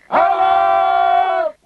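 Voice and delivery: long high yell across the water; desperate shout. Acted. Lost at sea without oars, yelling desperately for help. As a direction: bellow for rescue. Calling out on the ocean.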